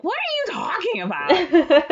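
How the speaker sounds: high, loud, slow baffled what; a prim woman outraged at nonsense; hammed-up affronted retort; indignant librarian. Marion baffled and annoyed.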